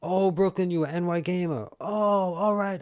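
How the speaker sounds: high, loud happy surprise at a familiar name; excited recognition. Real, not acted. Streamer recognizing a returning viewer in chat, excitedly high and loud.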